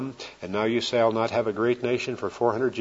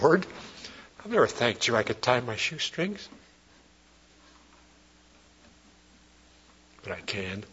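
tearful prayer; 7 dB quieter than usual. Retold or imitated. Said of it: "Sometimes I cried".

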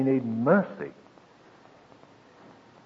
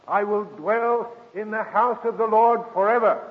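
higher, fuller restatement of the verse; lifted repeat of the promise; scripture recital; 6 semitones higher than usual. Acted. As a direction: sing it out a second time, rising.

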